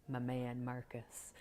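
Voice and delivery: low, soft, understated tribute; retold fond murmur. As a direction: imitate a quiet, dry fondness. Retold friend's fond, understated remark.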